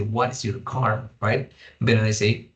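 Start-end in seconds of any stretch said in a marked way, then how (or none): 0.0-1.1 "What is your car?" spelled out word by word, slowly; slow, careful teaching read; slow and deliberate. Shown as a demonstration. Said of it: "pero no podemos decir".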